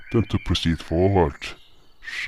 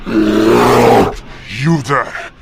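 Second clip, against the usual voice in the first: louder and higher warning roar; higher, loud defiant shouted warning; shouting; 10 semitones higher, 7 dB louder, slower than usual. Acted. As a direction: bellow at the charging beast. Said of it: "I shouted".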